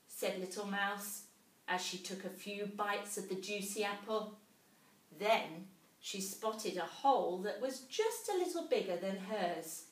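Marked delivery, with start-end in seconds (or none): none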